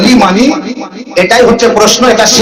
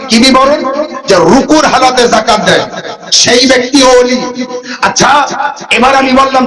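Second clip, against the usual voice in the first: ordinary talk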